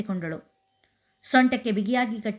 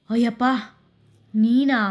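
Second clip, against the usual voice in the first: louder startled gasp of words; 2 semitones higher, 5 dB louder than usual. A narrator's reading. Bhumi startled by her brother's shout. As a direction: jump at the sudden shout. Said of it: "ಬೆಚ್ಚಿದಳು".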